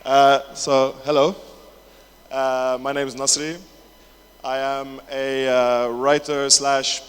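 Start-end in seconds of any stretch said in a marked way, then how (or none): none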